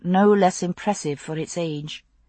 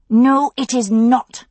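louder, slow words through gritted teeth; through clenched teeth; 6 semitones higher, 6 dB louder, slower than usual. Acted. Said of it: "she said through clenched teeth".

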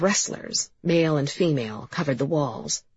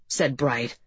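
usual speaking voice

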